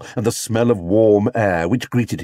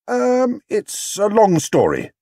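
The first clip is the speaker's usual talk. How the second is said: higher, hesitant bear, slowed; lame excuse; 10 semitones higher, slower than usual. Acted. Paddington dodging Mrs Brown's question, lamely. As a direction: fumble for an excuse. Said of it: "he said, lamely".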